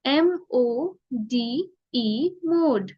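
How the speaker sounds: M ओ D E spelled very slowly, letter by letter; letter-by-letter teacher spelling. Shown as a demonstration. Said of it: "ओ का sound होता है ओ".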